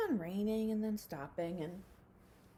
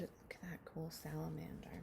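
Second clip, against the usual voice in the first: whispered aside; 3 semitones lower, 10 dB quieter than usual. Real, not acted. Teacher to children pausing the story, whispering about a salamander.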